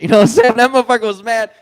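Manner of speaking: cracking up mid-story; laughing. Real, not acted. Laughing while telling the story.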